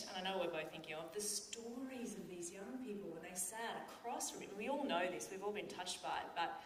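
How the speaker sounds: usual speaking voice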